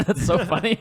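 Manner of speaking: bursting into high laughter; laughing. Real, not acted. Laughing at the joke.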